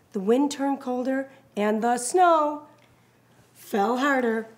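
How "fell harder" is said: ordinary delivery, nothing marked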